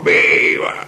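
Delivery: rough mock cheer from a football fan; imitated fan yell. Neighbour yelling from the balcony at a Boca match, mock shout. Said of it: "grita y sale a gritar".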